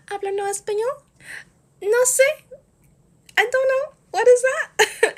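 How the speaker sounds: high, silly fake-confused voice; mock clueless voice. Pretending not to speak English to the club security, in a high mock voice.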